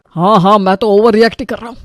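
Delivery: usual speaking voice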